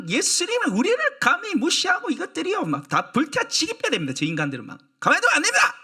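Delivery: seethe for revenge, peaking on the last line; hammed-up vengeful disciple begging Jesus; full of rage and vengeance. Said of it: "얼마나 분노와 복수심이 가득한지".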